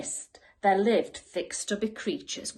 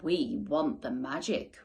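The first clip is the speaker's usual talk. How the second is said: goblin roar; 7 semitones lower, 5 dB quieter than usual. A narrator's reading. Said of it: "roared the goblins".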